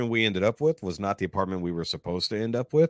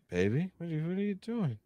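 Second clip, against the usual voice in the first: high, soft put-on female voice; imitated woman in bed; 10 semitones higher, 10 dB quieter than usual. Unknown woman in bed questions the dreamer, in a soft high voice.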